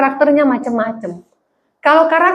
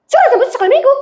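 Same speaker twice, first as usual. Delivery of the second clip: much higher and louder question that sounds like a scolding; loud, high demand; 11 semitones higher, much faster than usual. Retold or imitated. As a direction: fire the question like a telling-off. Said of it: "tante ini bilang gini". (tante bertanya keras seperti memarahi)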